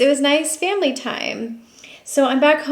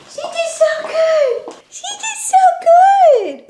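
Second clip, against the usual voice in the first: squeaky high pet praise; 4 dB louder than usual. Real, not acted. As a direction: coo it way up high. Praising dog.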